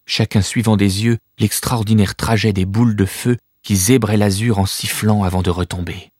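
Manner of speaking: ordinary delivery, nothing marked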